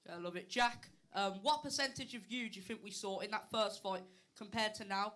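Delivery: ordinary talk, nothing marked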